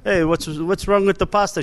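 imitated Sicilian accent, voice lifted; thick Sicilian accent. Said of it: "in his thick Sicilian accent".

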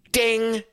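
high sung ding like a tech chime; bright electronic ping, mouth-made; imitated ding. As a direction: chime it out high, like the replay bell. Mimicking a challenge system ping.

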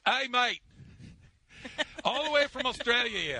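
a self-confessed bad Australian accent. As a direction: try on an Australian accent, hammed up a bit. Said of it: "I am gonna try it".

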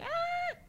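very high-pitched, softened mock wail; imitated child's wail. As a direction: mimic a toddler crying harder. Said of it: "más llora".

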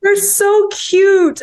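high, slow, loud gush. Real, not acted. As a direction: melt over how cute they are, drawn out. Gushing about the films.

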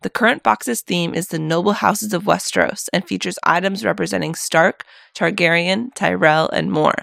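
upbeat sponsor read. Acted. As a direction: read it like a radio ad.

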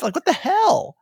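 high incredulous outburst; comic disbelief. Retold or imitated. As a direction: push it high, playing up bafflement. Comic disbelief at retold news.

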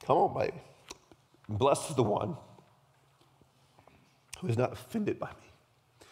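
fighting back real tears; halting, struggling to get the words out through emotion; choked up. Real, not acted. Preacher moved by the scripture he reads, choked up. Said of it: "this is why I'm crying".

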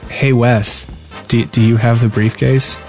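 ordinary talk, nothing marked

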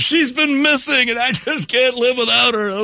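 high, slow hammed-up sob; fake-weep for the cameras; mock crying. Guilty husband crying to the news, hammed-up sobbing.

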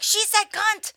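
high mock-shocked voice; scandalised exclamation. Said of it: "then everyone's like".

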